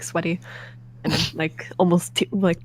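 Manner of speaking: ordinary delivery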